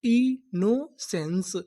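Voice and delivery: Innocence drawn out very slowly; slow sounding out. Shown as a demonstration. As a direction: stretch innocence for the class. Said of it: "पूरी meaning क्या बनी?".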